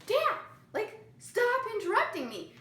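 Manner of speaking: high exasperated plea; annoyed plea. Retold or imitated. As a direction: beg him to stop, fed up. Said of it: "And I was like".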